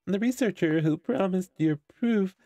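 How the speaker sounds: high, loud mocking villain voice; taunting villain. Game villain taunts the heroine.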